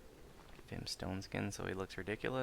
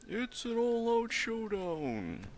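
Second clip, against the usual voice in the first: very high, slowly stretched announcer voice; Grogan impression; 13 semitones higher, 5 dB louder, much slower than usual. Gamer mimicking Grogan announcing the showdown, high drawn-out impression. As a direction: put on a high-pitched, loud arena commentator, drawing it out. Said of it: "That's my Grogan impression".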